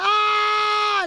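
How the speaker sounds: high shout as he drops; falling scream. Acted. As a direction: scream out while falling from the window. Teacher throws DJ out window.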